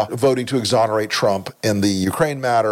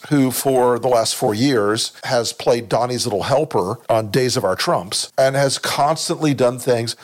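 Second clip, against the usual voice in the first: usual speaking voice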